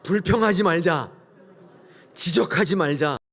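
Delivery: loud, high congregational call; call to repeat. Shown as a demonstration. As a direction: lead the congregation in a loud shout. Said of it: "우리 한번 따라하겠습니다".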